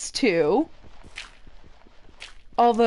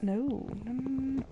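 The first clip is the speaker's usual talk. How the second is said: whispered self-correction; 13 dB quieter than usual. Real, not acted. Crafter whispering to herself as she fixes a mistake. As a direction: hush it out while fixing a mistake. Muttering over her craft.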